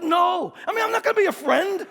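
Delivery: retold blunt New Yorker brush-off; blunt refusal. Preacher retells turning down a classmate's friendship, blunt. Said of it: "I was equally blunt".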